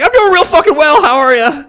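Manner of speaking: high, loud sarcastic cheer; mock cheerful. Answering the chipper paramedics, loud mock cheer. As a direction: fake chipper greeting.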